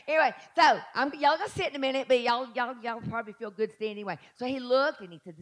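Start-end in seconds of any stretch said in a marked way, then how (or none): none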